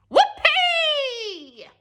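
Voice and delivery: put-on high, loud old hag whoop; shout. A narrator's reading. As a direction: whoop with wild glee. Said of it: "she shouted".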